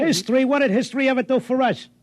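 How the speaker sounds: high, incredulous scoff; exasperated husband scoffing at history. Acted. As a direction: scoff at the idea, fed up. Scoffing at his wife's devotion.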